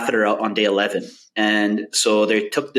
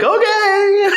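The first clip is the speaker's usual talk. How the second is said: drawn-out high okay; 6 dB louder than usual. Retold or imitated. Drugged patient stretches answer.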